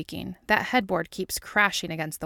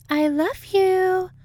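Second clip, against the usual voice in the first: very high, slow, louder put-on voice; hammed-up lovey voice; 13 semitones higher, 6 dB louder, much slower than usual. A narrator's reading. Dana to Mulder with a kiss, gushing. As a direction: coo it loudly, stretching each word. Dana's put-on declaration of love.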